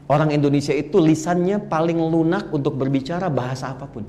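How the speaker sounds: normal talking voice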